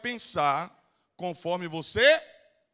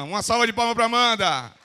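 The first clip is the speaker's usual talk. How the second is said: loud applause call; 4 semitones higher, 9 dB louder than usual. Real, not acted. Calling the audience to applaud.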